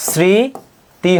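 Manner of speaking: স্মৃতি split slowly into "sriii... ti"; drawn-out S sound. Shown as a demonstration. Teacher showing students the sibilant, স্মৃতি very slowly as "sri... ti". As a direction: stretch the first syllable of স্মৃতি with an "s", pause before "ti". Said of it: "স এর মতো উচ্চারিত হচ্ছে".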